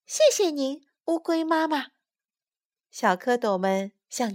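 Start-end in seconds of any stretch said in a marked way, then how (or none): 0.1-1.9 put-on high, polite child voice; small creature's high voice; little tadpoles. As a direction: thank her as a little tadpole. Tadpoles thank mother turtle.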